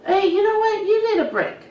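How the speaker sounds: put-on high-pitched friendly call to a kid; upbeat coaxing. Hairdresser offering a fidgety kid a break, upbeat coaxing. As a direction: coax lightly, pitched well up. Acted reassurance to fidgeting child.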